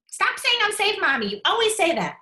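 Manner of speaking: put-on high, loud complaining kid voice; whiny child. Child snapping at mom, high and loud. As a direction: play the annoyed child pushing back.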